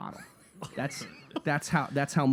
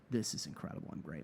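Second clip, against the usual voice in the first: breathy whispered self-praise, much softer; smug whisper; 8 semitones lower, 12 dB quieter, faster than usual. Retold or imitated. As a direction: whisper it to yourself, quietly pleased. Smug self-talk after the job.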